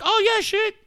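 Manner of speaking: high, careless eagerness; self-mockery. Man mocks himself gulping the dose, gung-ho. Mocking own careless dosing.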